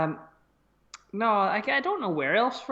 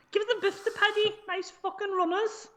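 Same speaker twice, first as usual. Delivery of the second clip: throatier Scouse accent; 11 semitones higher than usual. Shown as a demonstration. Contestant retrying after the host's throat note, guttural Scouse. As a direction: push the Scouse into the throat, pitched way up. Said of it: "the back of the throat".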